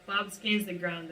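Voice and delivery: ordinary talk, nothing marked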